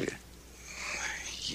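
slow conspiratorial whisper. Acted. Ken to Jim about fooling his wife, whispered. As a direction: lean in and breathe it slowly.

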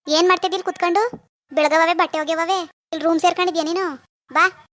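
loud, nagging reprimand; bossy mother-in-law telling-off; scolding. Acted. Mother-in-law scolds idle daughter-in-law.

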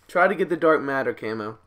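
ordinary delivery

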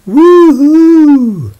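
very high, loud ghost howl; ghostly wail. Acted. Ghost crying out to scare the children with a howl. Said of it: "He cried".

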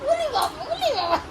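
soft, high-pitched cooing. Real, not acted. Asking a baby if it recognises her, high soft cooing.

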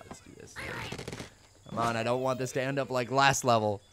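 ordinary delivery

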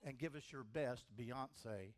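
loud, insistent hypnotist command voice; hypnotic suggestion. Acted. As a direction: plant the suggestion firmly, like a stage hypnotist.

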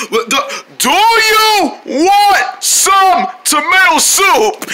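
very slow, high-pitched asking; as if to someone who can't understand; slow, loud enunciation. Acted. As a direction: spell it out word by word. Spelling out question to shrieking friend.

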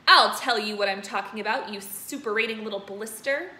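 loud angry shout. Acted. Headmistress shouting at Bruce. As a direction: shout the insult. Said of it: "the Trunchbull shouted".

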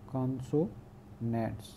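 Consonants slowly, softly split into parts; syllable by syllable. Shown as a demonstration. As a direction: break consonants up with a pause inside. Repeats term broken into syllables.

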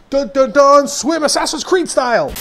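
loud, high action-hero announcement; mock heroic fanfare. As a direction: belt out a dramatic fanfare before the leap. Mock fanfare before jumping into water.

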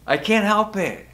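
ordinary talk, nothing marked

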